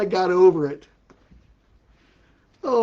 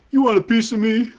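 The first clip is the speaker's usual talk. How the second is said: mock-angry challenge, slightly raised; angry cake; 4 semitones higher, 4 dB louder than usual. Comedian as a cake picking a fight, mock angry. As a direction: play a lightly riled talking cake. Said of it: "what did the angry cake say?".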